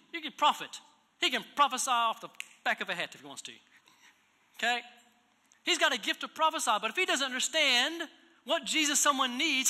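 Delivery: a preacher pressing the congregation, loud and high; full-force preaching. Acted. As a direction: drive the point home from the pulpit.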